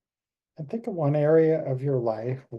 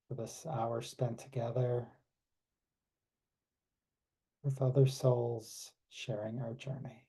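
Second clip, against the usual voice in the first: guided meditation. Acted. Leads closing meditation.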